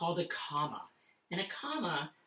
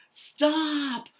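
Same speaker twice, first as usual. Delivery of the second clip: high, loud command; big yell; 10 semitones higher, 7 dB louder than usual. Shown as a demonstration. Teacher demonstrates an exclamation, loud high yelling. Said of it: "a big feeling and a big sound".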